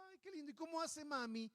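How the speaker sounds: cooing adults. Retold or imitated. Adults cooing over a baby.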